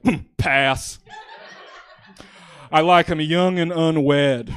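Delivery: loud, slow, booming dragon. Acted. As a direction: growl it out like a bored dragon. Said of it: "That was my impression of a dragon for you".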